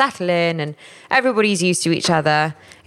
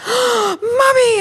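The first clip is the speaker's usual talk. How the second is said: put-on high, loud panicked child calling mummy; child's cry; 5 dB louder than usual. Mother imitating her son crying out at night. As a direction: wail it like a scared little boy.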